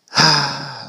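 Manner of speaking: heavy, breathy sigh; audible sigh. Acted. Speeder before admitting his lesson, a resigned sigh.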